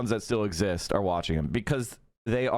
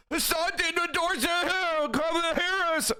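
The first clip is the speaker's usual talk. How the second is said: high-pitched, mocking read of a chat message; squeaky mock voice. Streamer reads a viewer's message, squeaky mockery. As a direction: squeak it out, poking fun.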